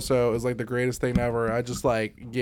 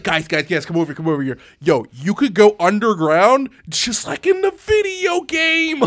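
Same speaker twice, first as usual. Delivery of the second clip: excited Miyamoto; 5 semitones higher, 6 dB louder than usual. Retold or imitated. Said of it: "he's like".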